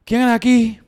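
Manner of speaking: concert crowd question. Retold or imitated. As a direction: ask the crowd like a performer. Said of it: "introducir causas sociales también a las preguntas".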